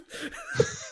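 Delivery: squeaky burst of laughter; high laugh. Real, not acted. Co-host cracks up at his own story, high laugh.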